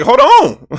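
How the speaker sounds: high, loud disbelieving hold on; stunned hold on. Retold or imitated. Vlogger replaying a shocking pass, loud and high. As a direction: stop the tape in shock. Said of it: "I'm like".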